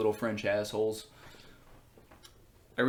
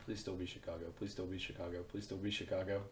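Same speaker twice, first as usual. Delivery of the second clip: quiet pleading; 3 semitones lower, 13 dB quieter than usual. Real, not acted. Hoping aloud at the crime map.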